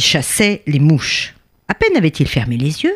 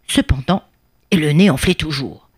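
ordinary delivery, nothing marked

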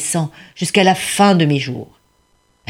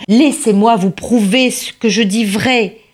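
hurlement désespéré; 6 semitones higher than usual. Acted. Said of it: "désespéré, il hurla".

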